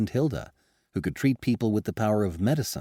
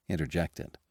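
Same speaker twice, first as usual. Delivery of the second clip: plain reading voice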